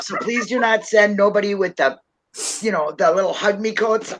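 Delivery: ordinary talk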